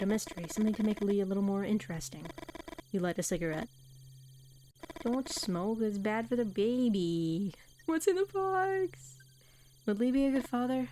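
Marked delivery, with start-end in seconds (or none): 5.0-10.9 character voice. Acted. Streamer voicing a game character.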